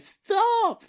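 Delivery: very high-pitched imitation scream; mock scream. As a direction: squeal it like a child trapped inside. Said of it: "Lily screaming from inside".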